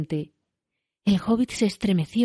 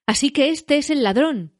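raised, higher exclamation; exclaiming; 7 semitones higher than usual. A narrator's reading. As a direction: burst out as Gandalf catching a thief. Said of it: "exclamó Gandalf". (exclamación alzada)

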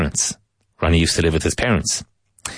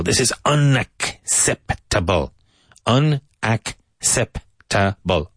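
very slow, every syllable spelled out; drawn-out careful drill of unacceptable; 3 semitones higher, much slower than usual. Shown as a demonstration. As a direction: break it into syllables slowly. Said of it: "this is a difficult word".

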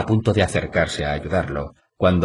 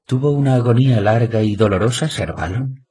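higher and louder, innocently sweet; sweetly innocent; 5 semitones higher, 4 dB louder than usual. A narrator's reading. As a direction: ask it lightly, like an innocent girl. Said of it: "con el tono que habría usado otra doncella".

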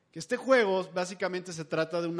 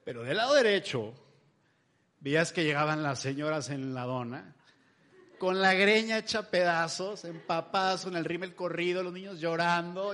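ordinary talk, nothing marked

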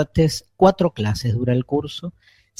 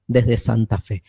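normal talking voice

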